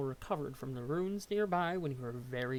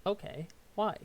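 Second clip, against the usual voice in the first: ordinary talk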